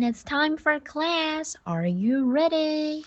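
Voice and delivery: slow, high, sing-song call to start class; rally the kids gently to begin; class opener voice. Acted. Teacher greeting her young pupils, slow sing-song.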